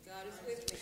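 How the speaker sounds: soft unvoiced echo; whispered response. Shown as a demonstration. Preacher whispering the congregation's reply. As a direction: breathe the words back without voice.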